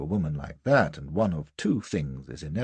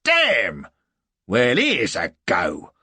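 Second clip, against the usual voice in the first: loud, raised, drawn-out exclamation; delighted astonishment; 4 semitones higher, 8 dB louder, much slower than usual. A narrator's reading. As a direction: marvel at it like a hearty sergeant.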